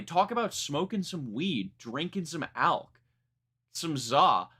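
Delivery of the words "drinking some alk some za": normal talking voice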